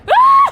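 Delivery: very high, piercing loud scream; sudden high yelp; startled shriek. Real, not acted. Player startled as someone gets stuck, shrieking.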